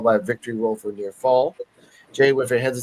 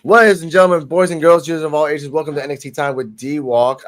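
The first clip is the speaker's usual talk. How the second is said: big, higher, louder showman announce; ringmaster; 4 semitones higher, 5 dB louder than usual. Acted. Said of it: "ringmaster-style welcome".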